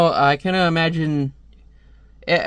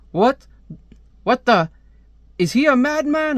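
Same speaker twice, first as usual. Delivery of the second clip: incredulous outburst; 9 semitones higher than usual. Acted. Villain taunted over the radio, high incredulous outburst. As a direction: sputter in rising disbelief.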